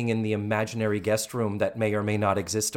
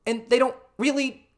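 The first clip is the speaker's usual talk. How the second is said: excited; 13 semitones higher than usual. Real, not acted. Excited gossip.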